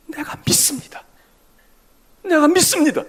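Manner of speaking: a whisper swelling into a loud cry of faith; plead, then call out. Acted. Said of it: "매달려 소리를 지릅니다". (속삭이다가 크게 외치는 고백)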